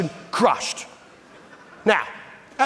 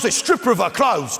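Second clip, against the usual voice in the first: ordinary talk, nothing marked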